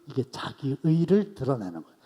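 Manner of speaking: ordinary talk, nothing marked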